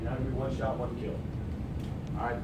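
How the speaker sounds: ordinary delivery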